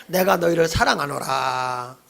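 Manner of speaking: low, slow, solemn voice of God; God's declaration. Shown as a demonstration. Preacher cueing the congregation as God, slow and solemn. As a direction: draw it out low and stately as God. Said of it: "한번 우리 리얼하게 해봅시다".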